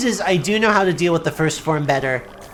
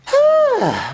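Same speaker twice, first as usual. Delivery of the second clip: exasperated sigh. Real, not acted. Exasperated at the game.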